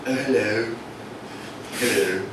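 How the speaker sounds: mock posh English horse lady greeting; posh horsey accent. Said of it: "putting on this accent".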